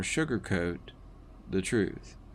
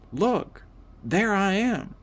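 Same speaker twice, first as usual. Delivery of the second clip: higher-pitched, thrilled exclamation, not truly yelled; excited shout; 10 semitones higher than usual. A narrator's reading. Narrator as a spirit seeing their future body, excited. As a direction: call it out with mild, delighted excitement. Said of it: "I kept shouting".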